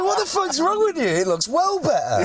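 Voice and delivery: high-pitched, disbelieving comeback; high mock-outraged protest; incredulous retort. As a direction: act out an indignant defence, pitched right up. Acted retort defending the smashed flat.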